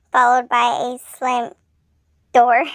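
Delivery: plain reading voice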